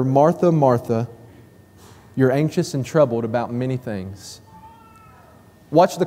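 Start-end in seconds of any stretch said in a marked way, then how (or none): 0.0-4.4 gently calm an anxious friend; loving. Acted. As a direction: lower and softer, full of compassion. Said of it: "can't you hear the love in his voice?".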